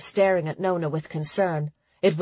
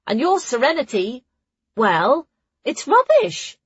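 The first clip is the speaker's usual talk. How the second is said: high, louder animated character voice; Ruli, objecting; 8 semitones higher, 4 dB louder than usual. A narrator's reading. Ruli doubting Nona's skill, objecting. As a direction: argue back in a high voice.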